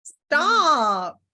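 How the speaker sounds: real disbelief, loud and high-pitched; shocked outburst. Real, not acted. Medium hearing an uncanny detail confirmed, shocked and loud. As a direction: cry out in stunned amazement.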